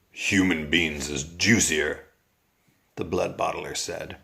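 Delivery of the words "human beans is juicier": a lower, slow grumble of a brute; lightly voiced grumbling ogre; gruff giant. A narrator's reading. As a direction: answer with heavy disdain. Bloodbottler's gruff reply.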